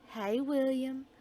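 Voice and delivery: normal talking voice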